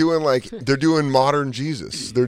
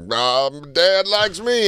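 slow, higher smug drawl; smug brother reply; 6 semitones higher, much slower than usual. Retold or imitated. A mock brother slowly teasing back about Dad. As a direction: stretch it out like a sibling showing off. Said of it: "And he's like".

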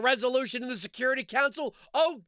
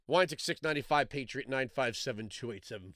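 muttered code; 10 semitones lower, 6 dB quieter than usual. Acted. Ad read inserted.

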